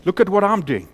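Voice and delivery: high, loud pleading cry of the crowd; mock shout. Preacher mimicking the world shouting to be chosen, loud pleading. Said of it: "the world is basically standing up shouting".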